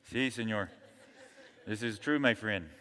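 lightly laughing Mexican accent impression.

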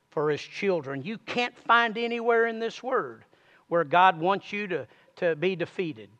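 hushed earnest sermon delivery; soft intense preaching. Real, not acted. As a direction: draw the congregation in quietly.

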